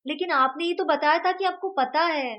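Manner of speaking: put-on high, loud little boy voice; child's voice. A narrator's reading. Vedant pleading with his friend to tell about his mother.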